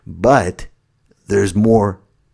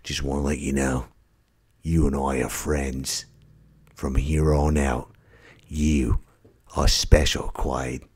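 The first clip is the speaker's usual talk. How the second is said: low, soft Australian celebrity impression; mock-sincere, halting Aussie message to a fan; Hugh Jackman; 6 semitones lower, 6 dB quieter than usual. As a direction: murmur it with solemn Australian earnestness. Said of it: "doing a selfie video like Hugh Jackman".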